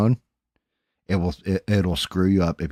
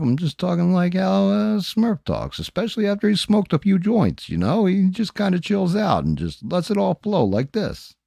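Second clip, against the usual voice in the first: chill and let it flow like a mellow stoner; Smurf's voice; 11 semitones higher than usual. Retold or imitated. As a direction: streamer mimicking his laid-back friend, much higher. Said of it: "when I'm talking like Smurf".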